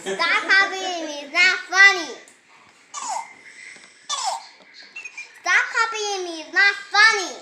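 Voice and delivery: loud, stress on copying and funny; loud telling off. Real, not acted. Kid shouts at the bird that repeats him, loud and cross. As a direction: shout at the toy to stop.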